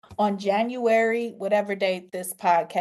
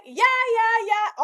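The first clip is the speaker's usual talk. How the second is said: happy; 12 semitones higher, slower than usual. Retold or imitated. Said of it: "I'm happy".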